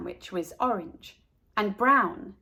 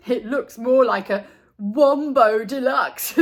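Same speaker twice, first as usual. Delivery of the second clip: louder mocking jab; teasing Carla about her sandwich; 2 semitones higher, 7 dB louder than usual. A narrator's reading. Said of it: "teased Buster".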